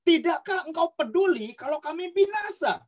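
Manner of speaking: high, pressing appeal to the teacher; desperate high-pitched cry for help; disciples crying out. Acted. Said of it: "murid-murid bilang gini".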